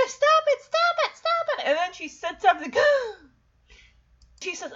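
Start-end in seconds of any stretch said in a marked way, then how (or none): 0.1-1.7 very high, laughing stop it; giggly protest. Retold or imitated. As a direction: squeal it through tickling giggles. Michelle laughing while tickled.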